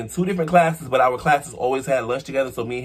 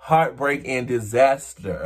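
ordinary talk

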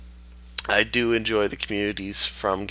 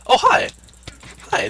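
much higher, louder startled greeting; surprised; 13 semitones higher, 7 dB louder than usual. Real, not acted. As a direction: jump at the sudden sight. Suddenly spots the enemy.

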